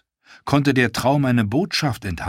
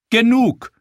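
high, loud sharp command; queen ending the argument with a sharp cry; commanding enough; 14 semitones higher, 7 dB louder than usual. Acted. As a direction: silence the room at once. Queen cuts off the quarrel.

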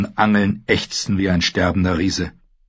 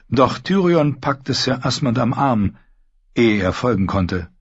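plain reading voice

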